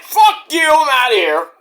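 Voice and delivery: high, loud mock outburst; hammed-up retort, pitched high; imitated angry yell. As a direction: yell it as an indignant exit. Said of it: "yelling at Prince Nana".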